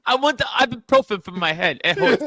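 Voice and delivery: a light mock toddler whine, voice lifted. Mocking a friend who says ibuprofen like a toddler, whiny. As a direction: whine like a little kid wanting medicine. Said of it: "You sound like a toddler".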